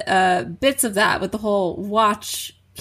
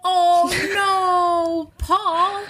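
mock anguish; 7 semitones higher, much slower than usual. Mock dramatic reaction.

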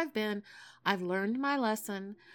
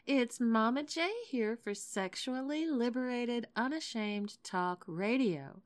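ordinary talk

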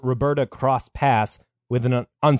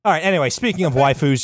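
ordinary talk

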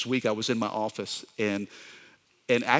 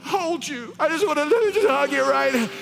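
high, loud, sobbing mock plea to be hugged; crying plea; 3 dB louder than usual. Pastor sobbing at his colleague to come in for a hug. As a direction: wail it through tears, half laughing. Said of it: "I am crying so loudly".

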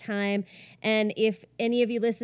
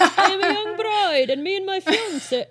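high, loud, hammed-up Irish accent; badly faked Irish lilt with a laugh in it; attempted Irish accent; 8 semitones higher, 7 dB louder than usual. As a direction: have a giggly go at an Irish accent. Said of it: "read this in an Irish accent".

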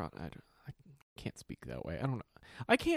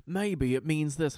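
slow, higher British YouTuber voice; mock British accent; 5 semitones higher, 5 dB louder, slower than usual. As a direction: imitate a British theory-video host, louder and drawn out. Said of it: "Are you all actually British".

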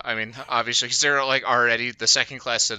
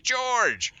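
calling out; 14 semitones higher than usual. Retold or imitated. Character shouting for fallen friend.

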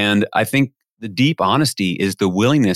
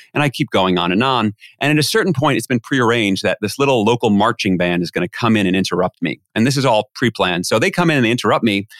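ordinary delivery

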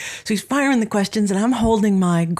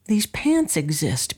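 ordinary talk

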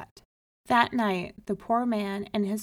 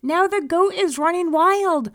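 high, loud frantic report; screamed complaint; 10 semitones higher, 10 dB louder than usual. A narrator's reading. Man telling the rabbi of the goat, frantic scream. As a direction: cry out in alarm. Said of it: "he screamed".

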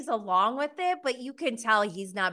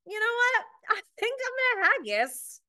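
much higher, airy mock voice; Kate imitated; 13 semitones higher than usual. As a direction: quip it in a high, breezy put-on voice. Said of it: "She's like".

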